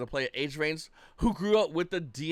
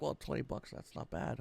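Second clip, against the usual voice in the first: grudging acceptance; 3 semitones lower, 10 dB quieter than usual. Retold or imitated. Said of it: "Everyone's like".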